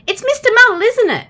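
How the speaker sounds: guessing voice. A narrator's reading. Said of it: "she guessed".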